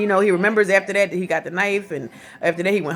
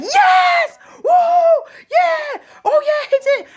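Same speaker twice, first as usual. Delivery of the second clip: much higher, louder jubilant cheering; imitating cheering screams; 6 dB louder, slower than usual. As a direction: whoop like the crowd celebrating. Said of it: "screams, like".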